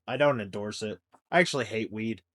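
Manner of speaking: normal talking voice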